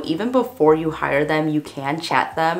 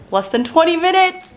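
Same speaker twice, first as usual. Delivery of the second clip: higher-pitched, giddy rise; real excitement; 11 semitones higher, 4 dB louder than usual. Real, not acted. Telling viewers the website publishes soon, excited. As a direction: let the excitement push the pitch up.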